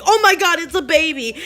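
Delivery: high, loud exclamation of alarm; imitating a mother's panicked discovery; retold shocked cry. Mother discovers baby in toilet.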